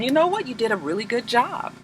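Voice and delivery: a soft, high and breathy voice for Olivia praising him; Olivia's soft praise. Retold or imitated. Olivia congratulates Cyrus after the debate, high and soft. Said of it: "she tells him".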